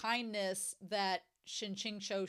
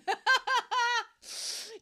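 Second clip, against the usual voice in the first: high, loud giggling; second giggle; 12 semitones higher, 6 dB louder than usual. Real, not acted. Vlogger giggling to viewers after repeating herself, high laugh. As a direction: let the laugh bubble out again.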